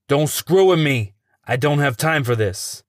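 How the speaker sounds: angry shout. Acted. Angry interrogator loses his temper.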